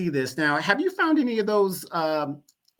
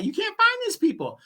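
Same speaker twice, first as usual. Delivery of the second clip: "find" hit much higher, exasperated; emphatic repeat; 14 semitones higher than usual. Real, not acted. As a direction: throw "find" way up in disbelief. Repeated line, second take emphatic.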